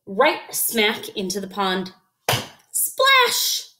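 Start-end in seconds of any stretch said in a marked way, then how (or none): none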